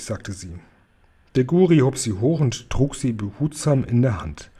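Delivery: plain reading voice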